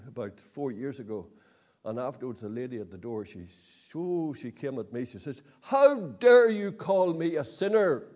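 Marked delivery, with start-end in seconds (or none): 5.6-8.0 loud, outraged high-pitched retort; indignant woman. Retold or imitated. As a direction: fling the accusation back, affronted. Angry woman confronting preacher.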